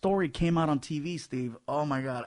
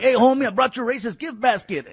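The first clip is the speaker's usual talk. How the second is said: higher, louder Chicano homie voice; 9 semitones higher, 9 dB louder than usual. Retold or imitated. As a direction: do a friendly homie from LA. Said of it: "they're like".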